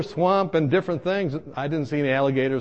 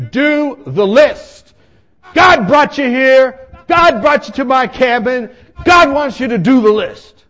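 loud, high, drawn-out urging; insistent shout; 9 semitones higher, 11 dB louder than usual. Retold or imitated. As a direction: hammer each phrase like a zealot. Acting out Tony's insistent urging.

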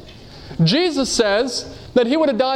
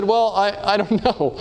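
nervous laugh; 3 semitones lower than usual. Retold or imitated. Retold reply to church request.

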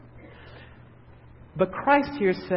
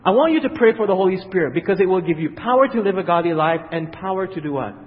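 usual speaking voice

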